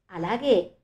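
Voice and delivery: usual speaking voice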